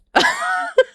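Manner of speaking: high, wheezing giggle; frustrated laughter. Real, not acted. Streamer itchy and annoyed, helpless laugh.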